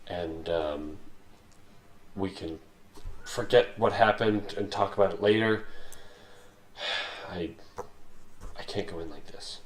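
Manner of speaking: hushed, tired plea to Roxley with a sigh; pleading softly in character. Acted. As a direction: murmur it low, as if worn out and bound. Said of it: "I'd be like".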